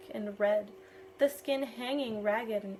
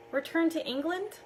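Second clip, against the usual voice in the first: stunned, rising question, higher pitch; shocked; 6 semitones higher, 3 dB louder than usual. A narrator's reading. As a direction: blurt it out in disbelief. Shocked by captain's news.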